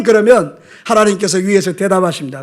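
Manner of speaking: ordinary talk, nothing marked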